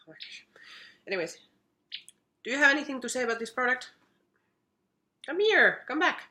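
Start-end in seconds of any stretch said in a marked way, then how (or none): 0.1-0.5 breathless whispered aside. Real, not acted. Reacting to her cat on the desk, a hushed whisper. Cat misbehaving near camera. 5.3-6.2 high, lilting call to a pet; calling the cat. Real, not acted. Calling her cat back as he walks away, high pet-calling voice.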